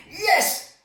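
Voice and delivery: a high, loud eager burst; eager yes. Retold or imitated. Peter blurting his answer first to Jesus, high and eager. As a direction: shoot the hand up and blurt it. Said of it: "he was the one to say".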